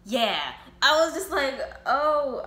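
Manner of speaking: stunned. Retold or imitated. Shocked reaction to girlfriend's death.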